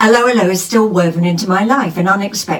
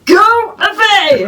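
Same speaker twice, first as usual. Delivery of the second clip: put-on high, slow German accent dismissal; 12 semitones higher, 4 dB louder, slower than usual. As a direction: shoo them off in a German accent. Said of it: "in a German accent".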